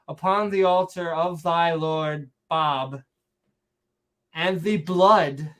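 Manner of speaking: slow, solemn fake-Shakespearean reading; mock Shakespearean accent. Reading scripture about the altar, slow mock Shakespearean accent. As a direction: draw out each phrase in mock Shakespearean. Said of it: "saying it in a Shakespearean accent".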